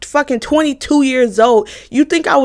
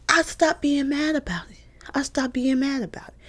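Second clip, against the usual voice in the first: breathy whisper, much softer, almost no voice; 3 semitones higher, 9 dB quieter than usual. Acted. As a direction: drop to a mock-calm whisper mid-rant. Calm claim, whispered mid-rant.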